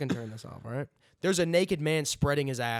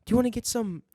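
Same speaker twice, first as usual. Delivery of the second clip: fake British accent; 9 semitones higher, 3 dB louder than usual. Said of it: "Their accent is fake".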